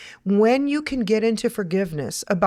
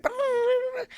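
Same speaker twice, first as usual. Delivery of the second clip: high-pitched blah-blah noise; mock babbling; 4 dB quieter than usual. Mimics pouring out complaints.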